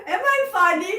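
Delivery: high giggling laugh around the question; real laughter at her own explanation. Real, not acted. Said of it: "[name], tell me a few words".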